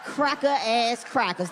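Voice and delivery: slow, drawn-out grudging chant; slow relish. Acted. Watching the news alone, slow relishing taunt. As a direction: savour each word slowly. Cheering Ferguson coverage alone.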